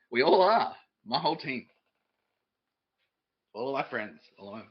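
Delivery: ordinary talk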